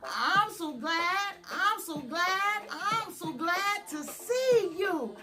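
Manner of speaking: usual speaking voice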